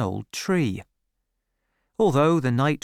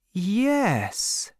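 higher, drawn-out yes; slow, hesitant yes; 7 semitones higher than usual. A narrator's reading. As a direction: say it slowly, pitched up. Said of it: "she said slowly".

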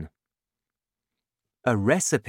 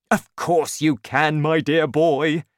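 loud, higher, confident; encouraging father; 5 semitones higher, 6 dB louder than usual. A narrator's reading. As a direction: brush off the boy's doubt heartily. Excited father encourages his son.

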